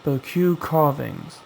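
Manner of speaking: ordinary delivery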